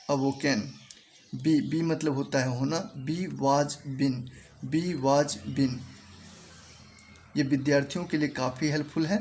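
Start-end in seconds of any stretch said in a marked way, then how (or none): 3.0-5.8 slow pronunciation demo. Shown as a demonstration. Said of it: "मैं इसको pronunciation करते जाऊंगा".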